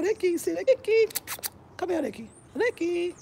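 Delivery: high, loud calls to Ricky; calling the dog. Real, not acted. Owner calling her dog Ricky to come over, high loud calls.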